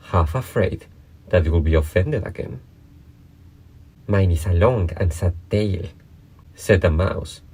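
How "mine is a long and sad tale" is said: ordinary delivery, nothing marked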